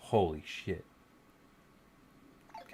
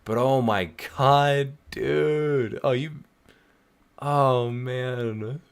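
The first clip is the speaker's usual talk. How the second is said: dawning joy; 4 semitones higher, 5 dB louder, much slower than usual. Real, not acted. Overwhelmed by creator's presence.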